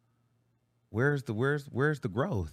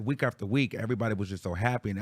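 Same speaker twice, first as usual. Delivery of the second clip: ordinary talk, nothing marked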